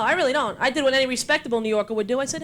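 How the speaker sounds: usual speaking voice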